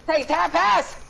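high, frantic call to a teammate; urgent shouting. Real, not acted. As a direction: yell for the ball as time runs out. Urgent calling for a pass.

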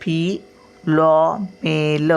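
slow, syllable by syllable; फिलॉमेल very slowly, for a class; drawn-out teaching word. Shown as a demonstration. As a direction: stretch फिलॉमेल out slowly, every sound separate. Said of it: "syllable-by-syllable teaching".

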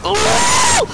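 very high, loud yelp; startled shout. Real, not acted. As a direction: yelp in sudden fright. Sudden scare in game.

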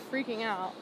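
ordinary delivery

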